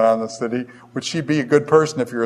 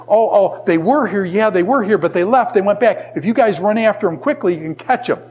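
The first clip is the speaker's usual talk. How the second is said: higher-pitched, eager-to-help bluff; hammed-up innocent tip-off, pitched up; Rahab's helpful lie; 6 semitones higher than usual. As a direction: act out sending the searchers off after them. Acting Rahab fooling the king's men.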